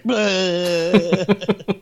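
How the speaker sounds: loud, high, drawn-out bleh; mock bleh. Viewer reacting to the aunt's twist, mock revulsion. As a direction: let out a long retching cry.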